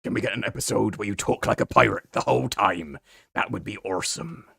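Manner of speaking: loud, hammed-up pirate; playful pirate impression, projected. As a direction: read it out like a pirate. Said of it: "a moderately good pirate voice".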